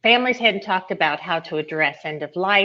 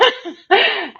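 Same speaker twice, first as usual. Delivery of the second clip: high, real peal of laughter; amused laugh; 6 dB louder than usual. Real, not acted. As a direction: keep laughing, high and loud. Laughs at her own joke.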